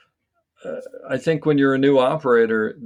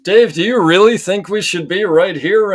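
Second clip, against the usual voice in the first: statement ending in a high rising question tune; uptalk; 7 semitones higher, 6 dB louder than usual. Retold or imitated. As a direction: make a request sound like a polite question, pitch up. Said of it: "she wasn't asking me all those questions".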